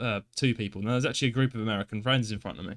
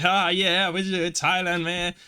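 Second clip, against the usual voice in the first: put-on American accent, higher and louder; mocking American tourist; 6 semitones higher, 4 dB louder, slower than usual. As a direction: play a careless American tourist at the passport desk. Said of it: "giving in their passport, like".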